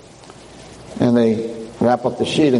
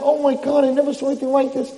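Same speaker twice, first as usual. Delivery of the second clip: tag; 11 semitones higher than usual. Acted. Onlookers react in horror.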